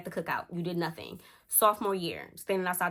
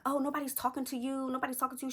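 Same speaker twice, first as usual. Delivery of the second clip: dismissive retort; 6 semitones higher, 5 dB quieter, faster than usual. Retold or imitated. Rival snaps at heckler.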